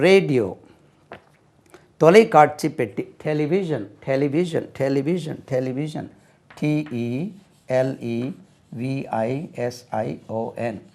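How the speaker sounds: normal talking voice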